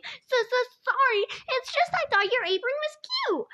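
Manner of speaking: high-pitched apology through held-back giggles; stifling giggles. A narrator's reading. Guest apologizing to robot about the apron, stifling giggles. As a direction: say it fighting down giggles. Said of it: "still trying to stifle more giggles".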